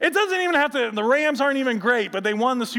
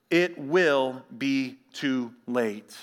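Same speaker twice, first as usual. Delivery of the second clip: normal talking voice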